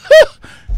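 very high, loud wordless outburst; imitated biker's cry. Storyteller imitating the biker's reply before he rides off, high mock cry. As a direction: let out a high imitated whoop.

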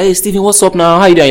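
cheery greeter. Retold or imitated. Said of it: "someone's like".

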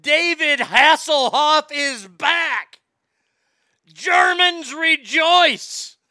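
high, loud, slow hammed-up announcer shout; mock announcer. Host announces Hasselhoff to chat, slow announcer shout. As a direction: boom it out like a ringside announcer.

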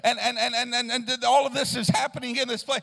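loud, fervent sermon cadence; full-throated revival preaching; preaching at full force. Acted. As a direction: lift the room with it.

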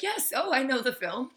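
high, chirpy mock enthusiasm; mock eager fan. Mimicking her upbeat reply to the skateboarder, high mock eagerness. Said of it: "I was trying to be topical".

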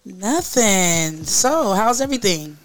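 ordinary delivery

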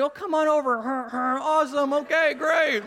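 high, sugary mock-sweet welcome; sweet loving person. Pastor mimicking his generous self in traffic, sweetly gushing. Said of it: "being this sweet, loving, caring person".

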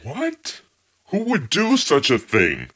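fake surprise. Feigning innocence over stolen tomatoes.

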